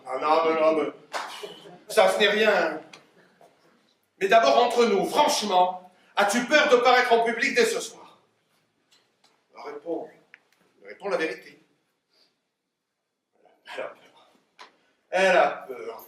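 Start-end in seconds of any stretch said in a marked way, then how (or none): 0.1-8.2 loud, higher, reassuring then probing; emphatic questioning. Acted. Father questions his imagined daughter before her debut, loud and emphatic. 13.8-14.3 unvoiced murmur to himself; hushed aside. Father notes his daughter's fear, hushed aside. 15.1-16.0 projected, delighted discovery; loud announcement. Acted. Father announces his daughter's fear as good, loudly.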